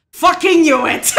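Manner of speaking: very loud, high, laughing yell; triumphant shout. Real, not acted. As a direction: burst out, thrilled to be proved right. Vindicated outburst at a game discovery.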